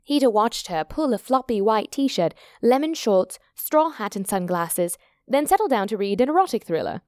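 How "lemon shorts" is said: ordinary delivery, nothing marked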